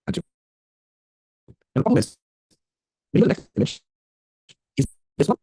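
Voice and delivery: choppy, cutting-out connection; glitching audio. Real, not acted. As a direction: voice breaking up over a bad line. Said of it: "Your voice is cutting off".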